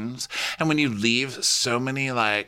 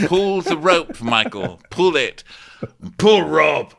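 loud, high mock command; imitated shouted order; 8 semitones higher, 6 dB louder than usual. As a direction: shout it up as an exasperated instruction. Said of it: "And Lewis is like".

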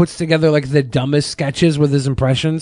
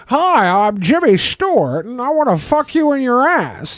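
high-pitched mocking impression of an old-time film star; Jimmy Stewart; 8 semitones higher than usual. Host mocking impressionists who name their subject, in a hammed-up film-star voice. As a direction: exaggerate the famous actor's voice, pitched up. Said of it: "say the name of who they're doing the impression of".

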